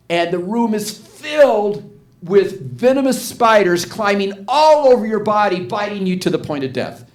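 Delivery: loud, high, hammed-up horror picture; dramatic worst-case build. Seminar leader making Rita imagine venomous spiders, retold with loud drama. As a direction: pile on the horror loudly, easing at the end.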